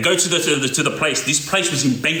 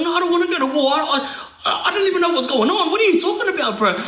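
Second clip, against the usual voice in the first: frightened protest; 11 semitones higher, faster than usual. Retold or imitated.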